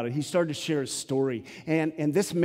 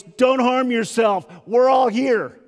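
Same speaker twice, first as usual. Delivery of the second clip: a big bold call across the cell; loud cry; 8 semitones higher, 8 dB louder than usual. Acted. Paul calling to the jailer, loud cry. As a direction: shout it out loud and slow. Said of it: "cries out with a loud voice".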